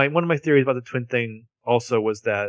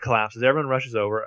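normal talking voice

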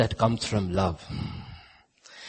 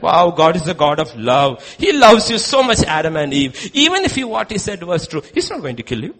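loud, gushing false reassurance; persuading devil; 4 semitones higher, 8 dB louder than usual. Retold or imitated. Devil persuading Adam and Eve, gushing and loud. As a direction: swing up high and loud, then ease down.

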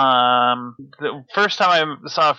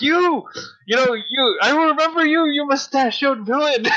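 imitating a scream; 11 semitones higher than usual. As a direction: yell it like road rage at the guy who cut you off. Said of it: "screaming at that dude".

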